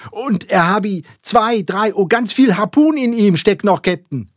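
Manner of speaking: high, loud, halting exclamation; excited stammering harpooner; stammering shout. A narrator's reading. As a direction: call out excitedly, fumbling for words. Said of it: "rief Kükeck stammelnd".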